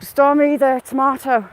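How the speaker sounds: loud, excited call with the name high. Real, not acted. Spotting a viewer in chat, calling out loud and excited. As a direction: shout the name to catch her attention.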